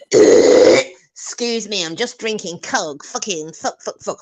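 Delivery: hammed-up Philly impression fading away; mock Philly voice. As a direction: imitate her Philly drawl, muttering off. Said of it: "And then she'll go".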